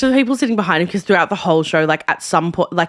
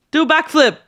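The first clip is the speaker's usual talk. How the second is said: high, punchy yell from the crowd; mock heckler shout; 7 semitones higher than usual. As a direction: heckle the stage in a raised voice. Imitates Sheldon's repeated heckle.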